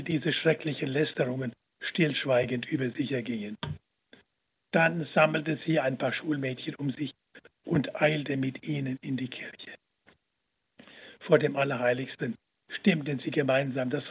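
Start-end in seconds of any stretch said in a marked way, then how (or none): none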